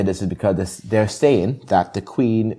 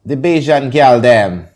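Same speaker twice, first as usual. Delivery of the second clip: a lightly put-on Bajan accent, a bit higher and louder; 4 semitones higher, 6 dB louder, slower than usual. Shouting out Barbados to listeners in a playful Bajan accent. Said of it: "that's my best Bajan accent".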